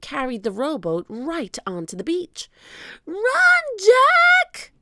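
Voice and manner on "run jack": very high, loud warning cry; piercingly high urgent yell; shouting. A narrator's reading. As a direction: scream a warning to a friend. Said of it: "shouted Annie".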